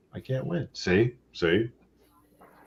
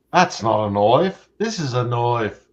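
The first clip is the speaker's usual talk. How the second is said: lightly put-on Australian accent, louder; Crocodile Dundee impression; 4 semitones higher, 6 dB louder than usual. Showing his friends his Australian accent with the knife line. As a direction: quote the knife line like an Aussie bushman. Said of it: "now he's Crocodile Dundee".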